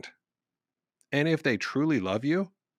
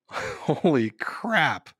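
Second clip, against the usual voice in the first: laughing; 3 semitones higher, much slower than usual. Real, not acted. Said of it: "Can you just imagine that scene".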